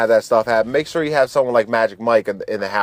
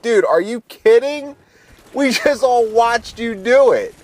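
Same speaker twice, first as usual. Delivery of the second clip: high-pitched, amused disbelief; mock incredulity; 9 semitones higher than usual. Friends to Joe after his denial, high mock incredulity.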